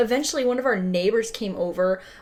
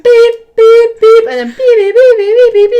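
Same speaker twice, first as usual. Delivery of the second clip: alarm beeps; 13 semitones higher, 12 dB louder than usual. Retold or imitated. Imitating the alarm.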